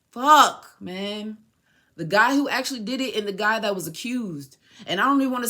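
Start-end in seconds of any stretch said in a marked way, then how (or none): 0.1-1.4 loud sudden curse; pained outburst. Real, not acted. Remembering the accused friend, a loud pained curse. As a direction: let it burst out in pain.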